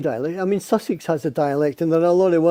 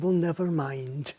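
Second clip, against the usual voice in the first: Morningside mother; 8 dB quieter than usual. Retold or imitated. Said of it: "And her mother said".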